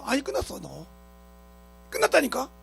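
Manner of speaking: normal talking voice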